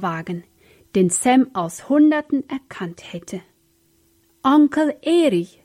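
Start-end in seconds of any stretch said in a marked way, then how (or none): 4.4-5.6 raised, higher-pitched call; excited cry. A narrator's reading. Sam spots his uncle's car, crying out. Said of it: "schrie Sam".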